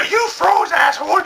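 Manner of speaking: high mock scolding; bitch slap. Girl scolding him, high and scornful. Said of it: "verbally bitch slaps him".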